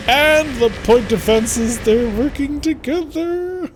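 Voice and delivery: drawn-out, climbing high-pitched exclamation; gleeful battle call. Real, not acted. Gamer cheering point defenses working together, gleeful. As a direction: stretch it out, pitch soaring to the end.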